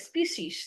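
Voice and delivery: স্পিসিস as 'spee-shees', 'ee' in both syllables; alternative pronunciation. Shown as a demonstration. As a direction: offer স্পিসিস with a long 'ee' twice. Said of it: "এরকম".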